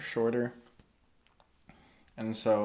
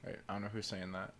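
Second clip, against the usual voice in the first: ordinary talk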